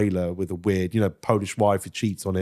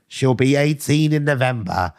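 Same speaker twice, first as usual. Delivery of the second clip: slow, odd put-on voice; imitating Justin; 2 semitones higher, 3 dB louder than usual. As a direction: drop it slowly like Justin. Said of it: "Justin went".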